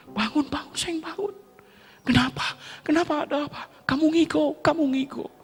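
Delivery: high, urgent waking call; urgent sister. Retold or imitated. Sister wakes her brother who talks in sleep, urgently. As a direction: shake him awake.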